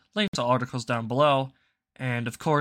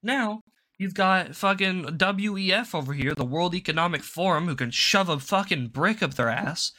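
usual speaking voice

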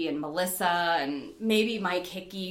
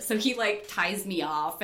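ordinary talk, nothing marked

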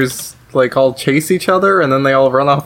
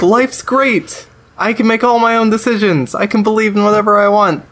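higher, chirpy mock-cheerful adult; imitating a grown kid; 7 semitones higher than usual. Dad imagines his grown son saying this, mock cheerful. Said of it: "he'll be like".

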